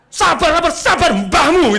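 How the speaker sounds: high, loud mocking shout; mock angry retort. Angry customer snapping back at staff who soothe him, mock furious. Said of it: "Oh, lagi marah begitu".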